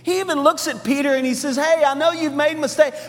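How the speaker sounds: high, loud, driving sermon climax; full-force preaching. Real, not acted. Preacher tells congregation what Easter is, impassioned full-force preaching.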